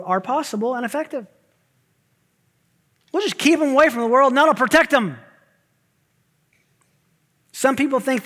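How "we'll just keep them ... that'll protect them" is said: loud, high, fast and breezy; a hammed-up know-it-all parent; mock naive confidence. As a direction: breeze through it like it's obvious. Voicing a mistaken parental view.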